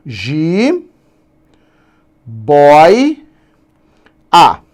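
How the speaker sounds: "ji", "boi", "a" each split apart with long pauses; syllables separated. Shown as a demonstration. Teacher splitting jiboia into syllables, one by one. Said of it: "então, eu tenho, ó".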